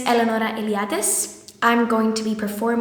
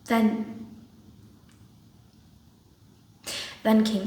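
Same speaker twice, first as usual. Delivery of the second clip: subdued Nina; 3 semitones lower, 7 dB quieter than usual. Acted. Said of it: "playing the part of Nina".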